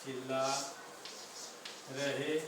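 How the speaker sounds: slow, word by word, long pauses between words; slow dictation. Shown as a demonstration. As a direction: dictate it so students can write along. (धीरे-धीरे शब्द-शब्द लिखवाना)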